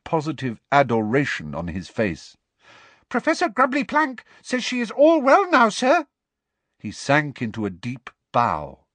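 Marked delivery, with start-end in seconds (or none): 3.1-6.1 very high, loud squeaky elf voice; squeakily. Acted. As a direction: squeak it with eager pride. Dobby speaking.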